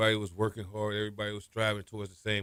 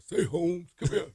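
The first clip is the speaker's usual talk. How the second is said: high-pitched impression of a godfather-like coach; Coach Casson; 8 semitones higher, slower than usual. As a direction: summon a player in a put-on, high voice. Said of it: "you meet the Godfather".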